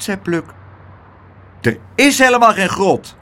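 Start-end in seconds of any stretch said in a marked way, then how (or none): 1.6-3.2 high, emphatic little doorman; gloating in a small high voice; triumphant little voice. A narrator's reading. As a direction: deny it with triumph. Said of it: "zei het stemmetje triomfantelijk".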